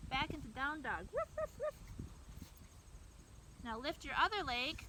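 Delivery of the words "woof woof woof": high, playful woofs; dog bark. Pretend. Back into down dog pose.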